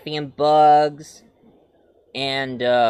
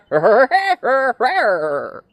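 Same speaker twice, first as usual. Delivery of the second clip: high goofy cackle; Woody Woodpecker style cackle; annoying cartoon laugh; 9 semitones higher, 6 dB louder than usual. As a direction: laugh like a silly rabbit.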